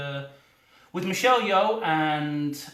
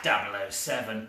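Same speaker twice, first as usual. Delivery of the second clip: mock M line; 9 semitones lower, 4 dB quieter than usual. M greeting Bond, low double oh seven.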